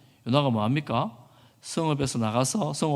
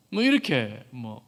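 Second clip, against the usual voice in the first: usual speaking voice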